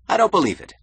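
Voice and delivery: high, loud, incredulous outburst; astonished disbelief. A narrator's reading. Keating hears Toohey's news, loudly incredulous. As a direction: burst out in disbelief. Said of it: "He added".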